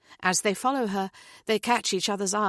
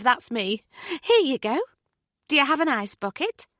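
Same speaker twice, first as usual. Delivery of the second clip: higher, louder, gushing British neighbour; bubbly neighbour Julie; 4 semitones higher, 3 dB louder than usual. Acted. Julie introducing herself to new neighbours, high and gushing. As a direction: breeze in all eager and chirpy.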